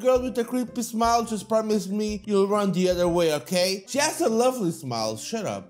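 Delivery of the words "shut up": muttered. Acted. Muttered retort.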